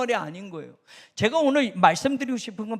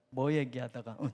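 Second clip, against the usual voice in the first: normal talking voice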